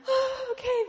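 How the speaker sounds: very high, tearful little reply; re-enacted tearful surrender, pitched right up; overwhelmed acceptance. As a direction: give in, close to crying. Overcome reply to God, cry heard.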